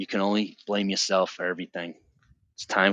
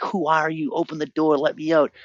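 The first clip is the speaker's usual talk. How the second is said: frantic; 7 semitones higher than usual. Retold or imitated. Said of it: "I'm like".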